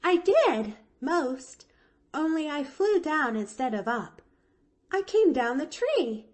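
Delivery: put-on high, gleeful little-girl voice. A narrator's reading. Pollyanna tells Nancy of her escape, high and gleeful. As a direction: announce it with skipping glee. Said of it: "Pollyanna skipped gleefully".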